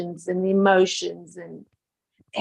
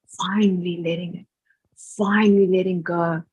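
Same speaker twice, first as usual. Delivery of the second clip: normal talking voice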